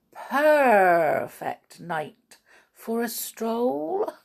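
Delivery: slow, stretched "perfect", rolled as perrrfect; purred pun on perfect. Acted. Bianca telling Bernard she was right, purring stretched "perfect". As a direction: draw "perfect" right out like a long purr.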